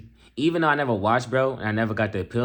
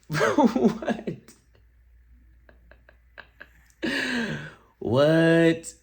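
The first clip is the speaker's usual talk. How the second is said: laughing disbelief; 6 semitones higher, much slower than usual. Real, not acted. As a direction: squeal through laughter in disbelief.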